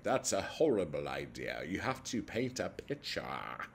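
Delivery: lightly put-on snooty literary voice; mocking snooty critic. Vlogger mocking fancy literature fans, snooty voice. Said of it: "who fancy yourselves fancy people".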